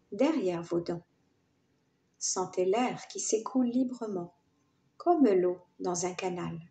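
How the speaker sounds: measured yoga nidra guiding voice with pauses between phrases; guided meditation with gently rising and falling phrases; guided relaxation. Acted. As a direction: lead listeners into relaxation, phrase by phrase.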